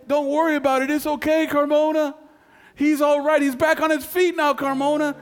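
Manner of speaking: urgent high-pitched relaying of news; excited messenger. Retold or imitated. Messenger soldier relays good news.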